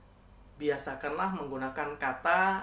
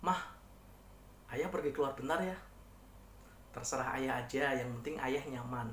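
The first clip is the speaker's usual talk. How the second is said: soft intonation; 3 semitones lower, 8 dB quieter than usual. Shown as a demonstration. Husband asks wife to go out, she agrees, soft intonation. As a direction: gently give permission, voice easing down. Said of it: "intonasi pelan".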